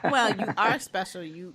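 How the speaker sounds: ordinary talk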